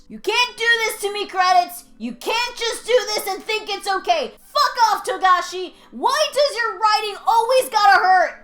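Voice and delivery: high, loud outraged cries at the screen; anguished yelling. Real, not acted. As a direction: rage at the credits through heartbreak. Yelling at credits and author.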